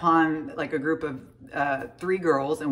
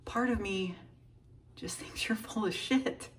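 hushed; 3 semitones higher, 9 dB quieter than usual. Retold or imitated. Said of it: "she said".

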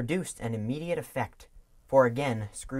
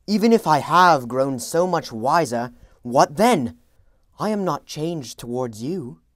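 put-on loud, raised young Scrooge; bitter young man; 4 semitones higher, 11 dB louder than usual. A narrator's reading. Young Scrooge to his fiancée, loud and bitter. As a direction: declaim it like a man defending his greed.

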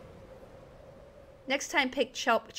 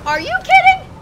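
very high, loud disbelief; outraged gamer's squawk; incredulous outburst; 10 dB louder than usual. Real, not acted. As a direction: shriek it in exasperation. Game progress lost.

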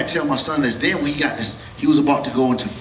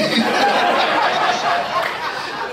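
very high breathy cackle; high laugh. Real, not acted. Comedian cracks up.

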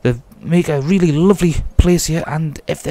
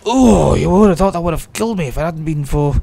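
ordinary talk, nothing marked

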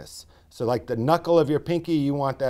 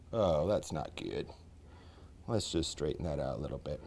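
muttered aside; 11 semitones lower, 13 dB quieter than usual. Real, not acted. Rim goes wobbly, aside.

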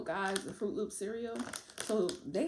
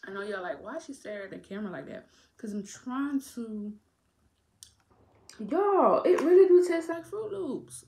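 words around a gummy; chewing while talking; 4 semitones higher, 9 dB louder than usual. Real, not acted. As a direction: mouth partly full of candy. Seen 0: Gummy put in mouth at -12; chewing motions, puffed cheeks while talking.

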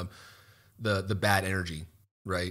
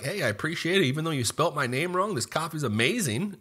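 upbeat, higher-pitched grateful customer; lilting, appreciative quote; friendly customer; 7 semitones higher than usual. Retold or imitated. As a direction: cheerfully thank the barista, pitched up. Models a friendly customer thanking barista.

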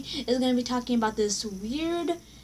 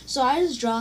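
ordinary talk, nothing marked

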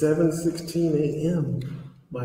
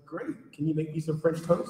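higher, softer imitation of a child; six-year-old child; 4 semitones higher, 7 dB quieter than usual. Six-year-old asking dad for French toast, lightly imitated child. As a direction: lightly voice an unimpressed small kid. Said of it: "my six-year-old comes in the room".

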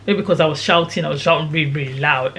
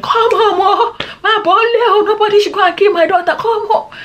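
high, hammed-up motherly wailing; imitated distraught mom; 13 semitones higher, 4 dB louder than usual. Storyteller mimicking mom on the phone about her daughter, high frantic wail. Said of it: "My mom was like".

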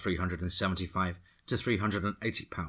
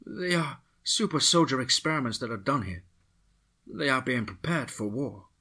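put-on higher, grave Latin-accented woman; Miss Vega; 6 semitones higher than usual. A narrator's reading. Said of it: "Miss Vega paused and then said".

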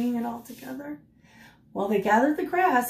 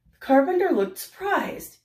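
ordinary delivery, nothing marked

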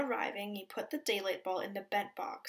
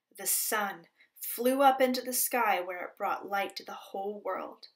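plain reading voice

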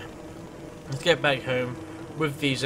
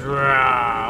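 a quick playful growl, like a beast; mock monster growl; 7 dB louder than usual. Warning of a monster coming, a mock growl.

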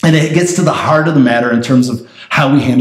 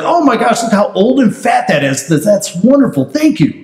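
higher-pitched, impressed gushing; delighted gushing; 5 semitones higher than usual. Acted. God thanking Abel for his livestock, delighted gushing. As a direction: play pleased amazement at a gift.

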